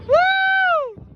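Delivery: high, loud "woo"; high cheering whoop; excited whoop. Real, not acted. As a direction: whoop it out at the fireworks. Cheering at fireworks show.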